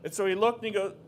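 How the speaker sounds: usual speaking voice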